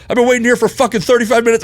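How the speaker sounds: fast, high, loud furious shout back; retold yell. Customer screaming back at the bartender over the wait, fast and furious. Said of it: "we're screaming at each other".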